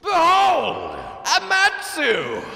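slow dramatic announcement; announcer shout. Acted. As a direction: proclaim it like a herald. Dramatic boss introduction.

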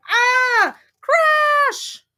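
high, loud storybook shriek; acted crash cry. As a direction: cry out as everything tumbles down. Carrots topple in the story.

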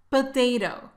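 normal talking voice

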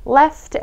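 Left with a crisp, audible F sound; clear F in left. Shown as a demonstration. Teacher drilling students: Left, F sounded clearly. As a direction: bite the lip for the F in Left. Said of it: "F 발음 정확하게".

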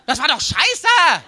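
loud and high, last words dragged out slowly; outraged shout. Retold or imitated. Comedian retelling how he alone yelled in the applauding hall, loud and slow. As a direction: yell it across the hall in disbelief. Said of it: "der schreit".

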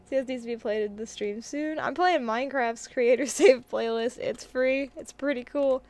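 ordinary talk, nothing marked

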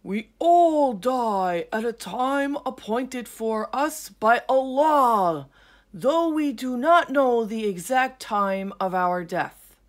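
put-on loud, slow, sleepy old lion; calm wisdom from a drowsy lion. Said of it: "The old sleepy lion replied".

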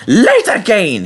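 high, drawn-out angry exclamation; indignant complaint, stretched out; cross shout. A narrator's reading. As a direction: shout it in exasperation. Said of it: "he shouted".

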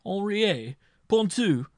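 high, loud alarmed cry of two names; trembling cry. A narrator's reading. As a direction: panic as you hear the names. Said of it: "cried the marshal, trembling".

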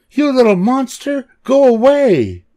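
put-on very high, loud girl's outburst; angry cry. A narrator's reading. Lucy banishing her little sister, high angry cry. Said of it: "Lucy cried".

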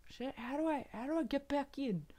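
a hint of a lost spirit searching; lost soul voice. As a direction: voice the confused soul lightly, wondering. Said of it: "he's like".

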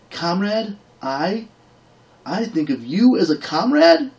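high, rising disbelieving cry; can't believe what she's hearing; a scornful warrior maid repeating the insult back; incredulous disbelief. Acted. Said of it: "voice rose in incredulous disbelief".

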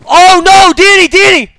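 high panicked yelling of a name; panicked shout. Real, not acted. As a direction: yell for your friend in a panic. Teammate under attack, panicked calling.

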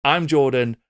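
ordinary talk, nothing marked

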